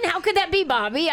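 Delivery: high teasing disbelief; mock surprise. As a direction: feign shock, playfully.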